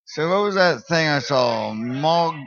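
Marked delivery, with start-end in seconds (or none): none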